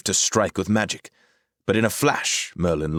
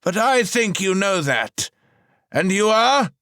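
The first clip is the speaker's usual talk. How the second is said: proud wizard; 6 dB louder than usual. A narrator's reading. Merlin announces his titles to Rahal, high and grand.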